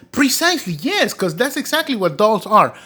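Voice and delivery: retold high eager yes; emphatic agreement. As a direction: insist with eager conviction. Retold emphatic answer to Larry.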